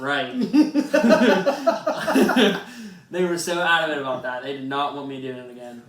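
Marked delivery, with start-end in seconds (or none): 0.0-2.6 high, loud, laughing right; laughing reply. Retold or imitated. Telling the prosecutor he won't do it again, laughing. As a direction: answer through a laugh.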